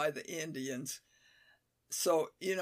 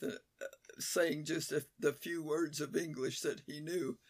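normal talking voice

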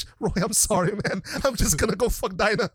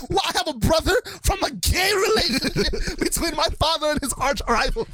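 mock outrage at the family news; hammed-up shocked John; 10 semitones higher than usual. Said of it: "And John's like".

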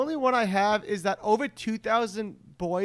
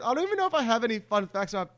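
normal talking voice

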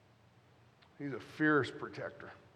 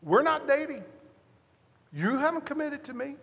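much higher mock indignant retort; mock wife retort; 11 semitones higher, 4 dB louder than usual. Girlfriend fires back at him, high and indignant. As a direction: fire it back as her. Wife's reply in retold fight.

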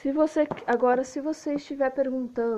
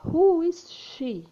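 high, loud "Who", very slow; stressed model question; 7 semitones higher, 4 dB louder, much slower than usual. Shown as a demonstration. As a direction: draw it out and lead high. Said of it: "você vai perguntar".

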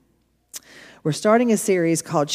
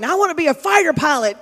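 put-on childlike eager; 9 semitones higher, 4 dB louder, faster than usual. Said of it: "me and my mama were standing on the couch saying".

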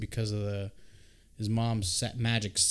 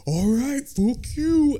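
much higher, louder outburst; mocking defiant shout; 12 semitones higher, 7 dB louder, slower than usual. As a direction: yell it as a cocky challenge. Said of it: "Dev's just like".

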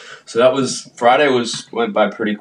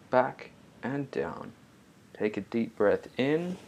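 soft mindful welcome, slowing down; guided meditation intro; 2 semitones higher, 6 dB quieter than usual. Acted. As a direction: ease the listener in gently, breathing with them. Recorded podcast intro with breathing.

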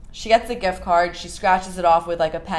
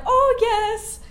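put-on much higher, sugary little-old-lady voice; sweet old woman voice. Imitating grandma on the phone to the scammer in a high sweet old-lady voice. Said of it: "like, a sweet old woman voice".

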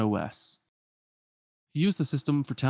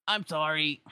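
higher, put-on apologetic voice; sheepish apology; 6 semitones higher, slower than usual. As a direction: say sorry to the friend who messed up. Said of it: "are all like".

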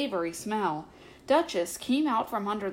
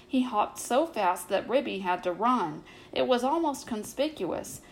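ordinary delivery, nothing marked

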